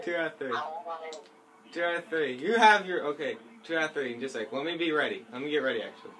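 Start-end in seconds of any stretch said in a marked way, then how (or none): none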